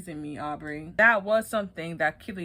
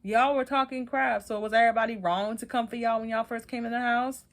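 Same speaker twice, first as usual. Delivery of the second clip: ordinary talk, nothing marked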